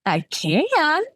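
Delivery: high, teasing tipsy lilt; giggly. Acted. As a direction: tease it out high, as if about to giggle. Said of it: "I said with a giggle".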